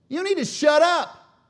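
high, very loud scolding shout; harsh rebuke. Acted. Peter pulls Jesus aside, harshly rebuking him. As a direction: scream at him in outrage. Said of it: "This was a harsh rebuke".

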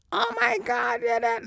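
exaggerated high squeak of a sick voice; very high, strained sick-voice imitation; imitating own sick voice. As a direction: squeal it out, mocking how you sounded. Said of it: "I was talking like that".

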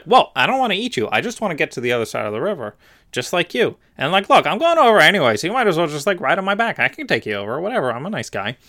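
high, breezy fake-friendly fox; sly fox. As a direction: sweet-talk him like a con artist. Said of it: "And the fox is like".

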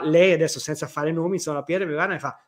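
ordinary talk

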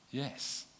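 ordinary delivery

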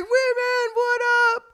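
very high mock fan yell; imitating a high, hollered greeting; excited kid's holler. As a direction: call out like a thrilled teenage driver. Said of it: "And he's like".

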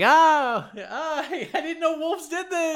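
high-pitched yelps breaking into laughter; hammed-up wolf-attack victim, half laughing; mock distress. Acts man being bitten by wolf.

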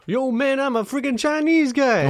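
high-pitched mock Chinese accent; hammed-up Chinese accent for laughs. Shown as a demonstration.

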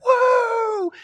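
high imitated crowd woo; crowd cheer. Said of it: "And they're like".

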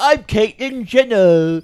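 hammed-up celebrity caricature; Caitlyn Jenner. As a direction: drag out a loud mock introduction. Mock impression in improv.

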